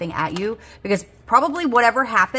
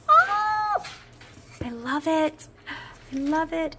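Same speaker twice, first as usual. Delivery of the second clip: delighted; 7 semitones higher, much slower than usual. Real, not acted. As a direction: coo over a lovely new thing. Delighted with new cash tray.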